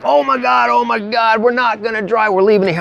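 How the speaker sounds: high, frantic mock panic; panicked friend. Friend panicking as the carburetor pours gas, high frantic mock panic.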